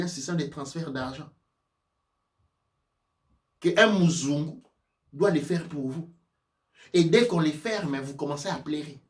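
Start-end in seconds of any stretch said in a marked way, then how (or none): none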